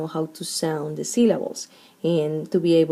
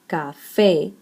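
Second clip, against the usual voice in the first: "café" split as ca, FÉ, the end stressed; stress on last syllable. Shown as a demonstration. Teacher explaining why café has an accent, stress on FÉ. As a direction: land firmly on FÉ in "café". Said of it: "the strong syllable is the last syllable".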